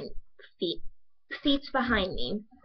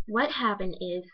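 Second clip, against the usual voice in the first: normal talking voice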